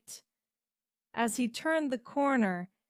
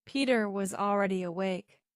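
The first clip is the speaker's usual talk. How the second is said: usual speaking voice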